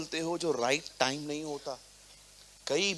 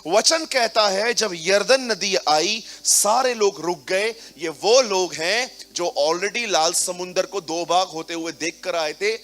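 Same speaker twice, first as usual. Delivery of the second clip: loud, fervent sermon delivery; rising pulpit fervor; full-force preaching; 3 semitones higher, 7 dB louder than usual. Acted. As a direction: preach it out to the congregation.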